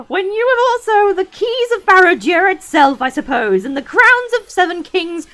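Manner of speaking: high, loud, mocking wizard scream; screaming Saruman. A narrator's reading. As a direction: shriek with wild scorn. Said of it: "his voice rose to a scream".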